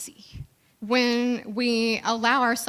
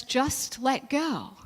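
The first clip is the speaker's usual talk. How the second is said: slow calm God voice; 3 dB quieter, much slower than usual. Retold or imitated. God answers calmly.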